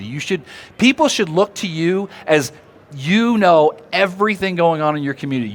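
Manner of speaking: normal talking voice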